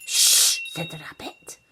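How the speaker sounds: sharp loud shh then a whisper; hushing. Acted. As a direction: hush her firmly. Rabbit hushes Olivia.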